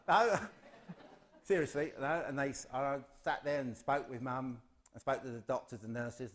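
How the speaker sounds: ordinary talk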